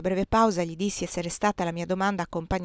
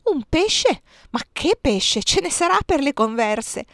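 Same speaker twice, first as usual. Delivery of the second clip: surprised exclamation; 7 semitones higher, 4 dB louder than usual. Acted. Nuns react to the fish.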